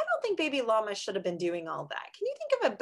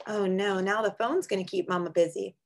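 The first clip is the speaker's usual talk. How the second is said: normal talking voice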